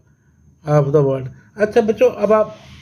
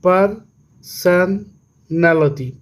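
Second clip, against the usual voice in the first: slow "personality", broken as पर, सन, नैलिटी; pauses inside personality after पर and सन; syllable by syllable; much slower than usual. Shown as a demonstration. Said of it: "इसी तरह से". ("Personality" धीरे-धीरे, हिस्सों में तोड़कर)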